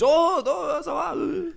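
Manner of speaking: high French greeting; imitating a delighted French stranger. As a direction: gush like meeting a fellow countryman. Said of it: "there's another French".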